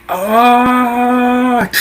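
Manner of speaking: hammed-up grunt of release; mock relief groan. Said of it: "it's just like".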